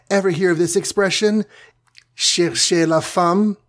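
lightly voiced heroine, high-pitched; tiny heroine's quip. A narrator's reading. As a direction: ask teasingly in a high character voice. Wasp's quip voiced by narrator.

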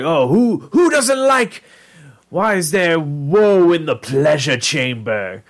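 high, slow, dramatic lament; theatrical creature. Retold or imitated. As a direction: wail it like a hammy creature. Dream creatures protesting.

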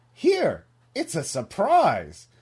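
high, loud, drawn-out cartoon critter; sing-song offering, starting very high; Mister Mouse. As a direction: pipe up, then stretch it out playfully. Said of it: "finally to Mister Mouse".

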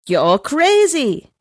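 plain reading voice

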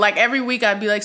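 ordinary talk

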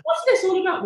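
giggly high-pitched protest; laughing high squeak. Real, not acted. Singer embarrassed by his own accent, laughing high. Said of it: "Why am I doing the accent?".

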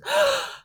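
imitated big dramatic gasp; sharp gasp. Mom on a video call, gasping dramatically. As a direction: gasp like mom just finished the show.

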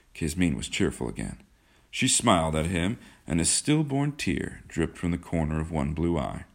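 ordinary delivery, nothing marked

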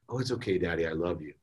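soft, low, gentle reply; murmured reassurance, acted as a loving daughter; tender daughter. Sleepy daughter forgiving her daddy, tender.

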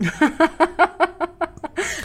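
high breathy nervous giggle; nervous laugh. Real, not acted. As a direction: let out a short jittery laugh. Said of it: "Это нервный смех".

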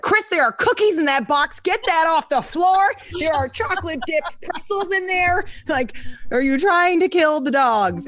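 high, urgent mock scolding of a friend; alarmed scolding. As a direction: warn him off like a panicked mom. Scolding producer about cookie box.

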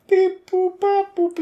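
high robotic beeps; toy robot voice. Acted. Random generator noises.

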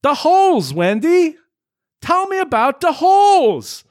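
high, loud, over the top demand; hammed-up high bellow at Wendy; mock dramatic yell. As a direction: shout it like a B movie hero. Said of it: "leads to the immortal line".

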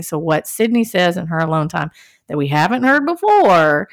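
normal talking voice

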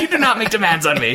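high, indignant mock outburst; acted rant on the edge of laughter; hammed-up yelling. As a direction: lay down the law like an unstable villain. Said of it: "every time he yells".

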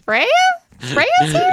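very high squeaky cartoon girl imitation; vintage cartoon voice. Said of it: "You just sounded like a cartoon character".

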